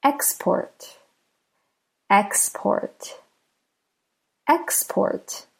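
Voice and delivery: "Export" with EX stressed, PORT lighter; stress on first syllable. Shown as a demonstration. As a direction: say "Export" as the noun, beat on EX. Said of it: "the X is emphasized there".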